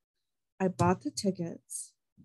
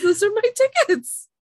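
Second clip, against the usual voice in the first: much higher and louder, final demand; loud insistence; 13 semitones higher, 7 dB louder than usual. Retold or imitated. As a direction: hammer it home, almost shouting. Insisting to ticket agent.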